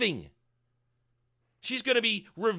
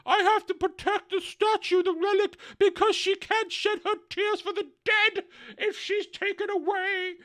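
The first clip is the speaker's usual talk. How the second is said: put-on much higher quavering old man; mock old man; 13 semitones higher than usual. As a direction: plead for the relic, pitched up. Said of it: "some old dude doesn't wanna go".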